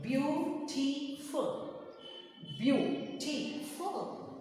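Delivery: "beautiful" in three slow, separate beats; teacher sounding out each syllable of "beautiful". Shown as a demonstration. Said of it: "Three syllable word".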